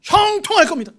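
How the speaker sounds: booming, high-pitched shouted proclamation; hammed-up, very loud priest's pronouncement; mock priestly blessing. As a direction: belt out the blessing like a showy false prophet. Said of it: "그랬더니 뭐라고 할게요".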